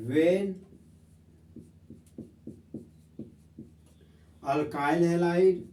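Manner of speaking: very slow, word by word, for notes; slow dictation. Shown as a demonstration. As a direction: dictate each word slowly to the class. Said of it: "अब हम लोग दोस्त इसका definition लिखेंगे". (धीरे-धीरे लिखवाना)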